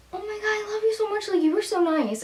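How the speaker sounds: high, breathy-soft gush; gushing thanks. Retold or imitated. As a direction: squeal your gratitude softly, very high. Gushing thanks to the helpful girl.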